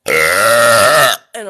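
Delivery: slow deep burped line; burping the words. Acted. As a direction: drag it out on a huge burp. Burp after hiccup talk.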